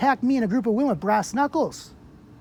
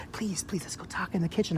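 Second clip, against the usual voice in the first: much softer, pleading; retold quiet plea; 3 semitones lower, 11 dB quieter than usual. Urging the officer to move to the kitchen, softly pleading. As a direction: coax her away under your breath.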